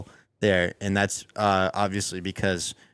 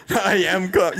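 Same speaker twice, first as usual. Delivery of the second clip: mock yell; 7 semitones higher, 3 dB louder than usual. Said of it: "yelling at somebody".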